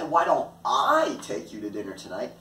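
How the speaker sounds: "I" held long and punched; stress on I. Shown as a demonstration. Teacher shifting focus to who takes her, stress on "I". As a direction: lean hard on the word "I". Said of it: "here we shift the focus".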